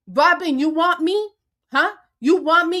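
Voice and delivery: a furious dare shouted at Robin; angry challenge. Real, not acted. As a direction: call Robin out hard.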